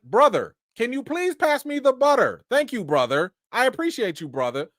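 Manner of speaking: overly polite, stilted brother at dinner; a hint of a stiff, formal brother; mock stiff sibling. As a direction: parody wooden dialogue, lightly voiced. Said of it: "do y'all be like".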